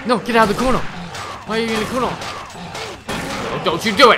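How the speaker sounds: loud, high, panicked scolding; real high-pitched shout; alarmed shouting. Real, not acted. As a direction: yell at the attacker to back off. Trapped by demon dogs in game.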